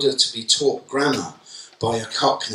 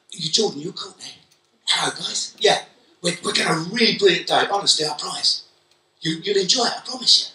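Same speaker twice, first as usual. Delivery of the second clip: higher, bright friendly greeting; friendly teacher voice; 7 semitones higher than usual. Retold or imitated. Teacher greeting two wary kids, friendly and reassuring. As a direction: win the kids over gently and kindly. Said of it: "it changes my voice".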